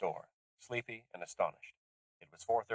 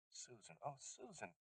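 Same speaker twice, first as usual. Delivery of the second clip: much softer, tender murmur; tender comfort; 3 semitones higher, 19 dB quieter than usual. Acted. Lisha comforting weeping Susan, tender and soft. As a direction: soothe a crying friend.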